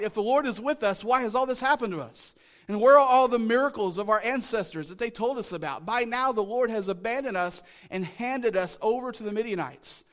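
a touch of aggrieved questioning; Gideon's protest. Acted. Preacher reads Gideon questioning the angel, mild protest.

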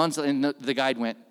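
ordinary talk, nothing marked

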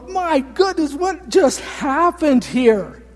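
high, slow, shouted amazement; preacher acting out an onlooker's shocked yell; astonished shout. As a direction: cry out in disbelief at the sight. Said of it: "you'd go".

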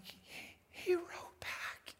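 hushed, breathless whisper of wonder; awed whisper. Retold or imitated. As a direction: whisper it like a kid who can't believe it. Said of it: "And I was like".

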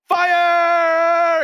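imitating a preacher's sudden, high, loud shout; shouting fire. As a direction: belt out "Fire!" as the preacher praying over him did. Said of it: "And then he goes".

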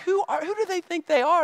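high-pitched retold outrage; worked-up indignation. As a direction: act out indignant disbelief, pitch jumping up. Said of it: "getting myself all worked up".

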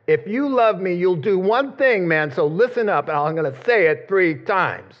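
ordinary talk

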